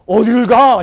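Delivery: loud, frantic question; panicked outburst. Retold or imitated. Father loudly panicking about where to go. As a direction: blurt it out in panic.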